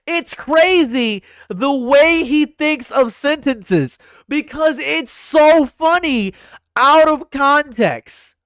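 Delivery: high, loud, drawn-out raving; excited shouting. Real, not acted. Streamer raves about how funny Trump sounds, slow excited shout. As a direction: belt out each phrase in delighted amazement. Marvels loudly at Trump's speaking style.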